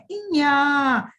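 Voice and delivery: इया held long and clear; slow clear model. Shown as a demonstration. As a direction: stretch इया for the kids to copy. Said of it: "अच्छे से उच्चारण कीजिए".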